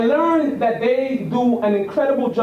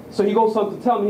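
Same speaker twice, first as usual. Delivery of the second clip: normal talking voice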